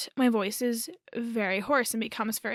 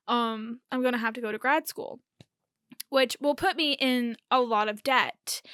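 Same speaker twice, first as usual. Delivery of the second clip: normal talking voice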